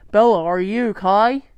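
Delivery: frightened father through the door. A narrator's reading. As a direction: call through the door, worried. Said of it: "His voice was frightened".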